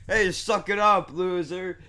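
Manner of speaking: high, loud jeer; mocking bride. Bride jeering at the crying brother, mocking. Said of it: "but she's like".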